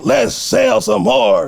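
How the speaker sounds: slow, high, laughing pitch; drawn-out eager sell; eager salesman. Retold or imitated. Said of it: "I'm like".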